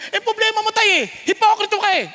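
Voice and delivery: high, loud sassy retort to a parent; a child scolding their dad, rude and loud; disrespectful to father. Pretend. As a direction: talk back rudely to your father. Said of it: "kung kakausapin yung tatay mo".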